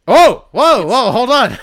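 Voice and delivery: loud, high mock startle; hammed-up shock at the intruder; mock surprise. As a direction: act caught off guard, loud.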